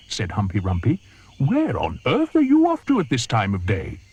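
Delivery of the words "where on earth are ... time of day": higher, louder nosy question; curious hippo. Acted. Humpy Rumpy asking the crocodile where he's going, curious.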